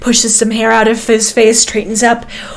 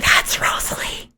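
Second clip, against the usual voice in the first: excited hushed whisper; 10 dB quieter than usual. Acted. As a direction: whisper it in awe.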